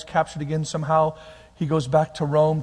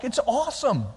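ordinary delivery

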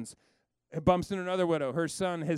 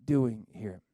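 very slow, much lower, hushed divine question; God slowly asks Elijah once more; still small voice; 3 semitones lower, much slower than usual. Acted. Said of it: "God asked him the question twice".

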